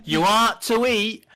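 loud, high, slow Northern English; Northern English dialect. Retold or imitated. Customer repeats himself to baffled shop girl, broad Northern English. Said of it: "older Northern people have a lot of their own slang".

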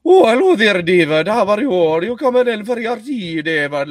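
loud, high, hammed-up Isle of Harris lilt; Isle of Harris accent. Shown as a demonstration. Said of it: "then you get the Isle of Harris".

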